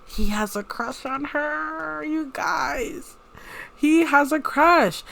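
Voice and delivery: high, drawn-out squeal of delight; gushing excitement. Real, not acted. Player tells viewers about a crush, gushing and teasing.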